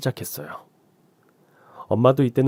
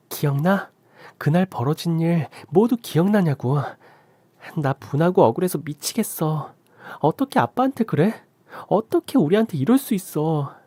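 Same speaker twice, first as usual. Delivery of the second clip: higher, pleading outrage at the father; indignant daughter; 6 semitones higher than usual. Acted. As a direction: demand answers in a high, aggrieved voice. Daughter cries out in anger.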